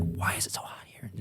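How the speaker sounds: retold as a hushed whisper; whispered aside of disbelief; whispered complaint. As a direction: whisper it like a private grumble. Muttered complaint to self, retold.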